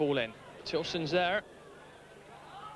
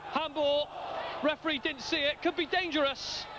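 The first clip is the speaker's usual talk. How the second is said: ordinary delivery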